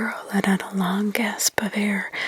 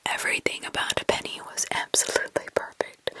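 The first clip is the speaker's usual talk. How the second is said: ordinary delivery, nothing marked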